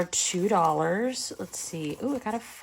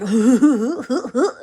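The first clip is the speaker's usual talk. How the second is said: loud laugh; 5 semitones higher, 7 dB louder than usual. Real, not acted. Laughing out loud at her husband's story. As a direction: burst of real laughter.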